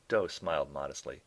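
ordinary talk

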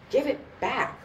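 high, restrained screech; rising screech. A narrator's reading. Woman demands her purse from the gangster, high mild screech. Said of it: "Her voice rose into a screech".

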